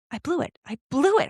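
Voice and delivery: high, mildly exasperated self-reproach; mad at herself. Retold or imitated. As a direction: kick yourself over a missed chance. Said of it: "I was so mad at myself".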